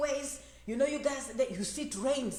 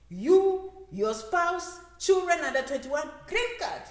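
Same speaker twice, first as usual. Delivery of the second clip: excited announcement; 5 semitones higher, 6 dB louder, slower than usual. Real, not acted. Excited listing of green card.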